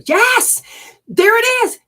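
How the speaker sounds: high and loud burst of recognition; excited yes. Real, not acted. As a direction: jump up in pitch, almost a shout. Sudden breakthrough during a reading.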